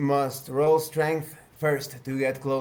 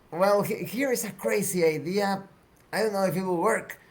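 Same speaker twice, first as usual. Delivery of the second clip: higher, halting character voice; Arisa; 5 semitones higher than usual. Acted. Woman suggests shooting at branch, higher character voice. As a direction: propose it in a raised pitch, with hesitations. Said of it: "Arisa exclaims".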